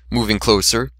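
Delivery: plain reading voice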